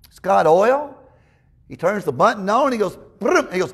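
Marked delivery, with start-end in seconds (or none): 3.2-3.5 a high, sputtering "brrr" of a chainsaw motor; chainsaw sound imitation. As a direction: mimic a chainsaw starting up. Imitating chainsaw that won't run.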